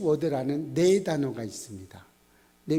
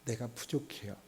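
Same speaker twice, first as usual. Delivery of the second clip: humble prayer; 5 semitones lower, 11 dB quieter than usual. Pretend.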